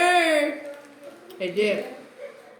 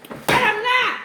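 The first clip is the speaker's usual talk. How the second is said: frustrated exclamation; 13 semitones higher, 3 dB louder, slower than usual. Real, not acted. Frustrated at missing the flies.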